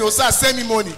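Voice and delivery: ordinary talk, nothing marked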